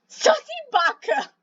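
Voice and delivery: very high, put-on cartoon voice; squeaky anime insult. Cat squeals a silly insult, cartoonishly.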